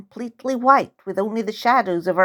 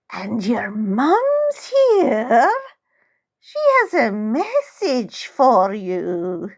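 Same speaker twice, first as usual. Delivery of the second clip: put-on eerie old woman; 3 semitones higher, slower than usual. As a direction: drawl it out slow and spooky like an old crone.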